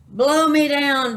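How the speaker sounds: loud, high, drawn-out pirate bellow. A narrator's reading. Pirate captain rejects the man's answer, bellowing. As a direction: bellow it out slowly and indignantly. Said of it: "bellowed Pete".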